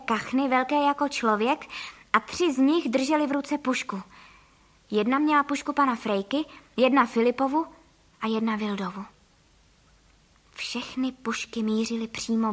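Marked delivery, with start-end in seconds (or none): none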